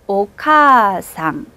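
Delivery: 오카상 with 카 held two beats, slow; 오카상, draw out the 카; long vowel. Shown as a demonstration. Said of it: "한 번 더". (오카상 카를 두 박자로 길게)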